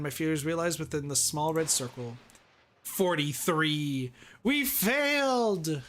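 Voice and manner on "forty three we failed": dismayed wail. Acted. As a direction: drag it out in dismay. Dismay at failed test.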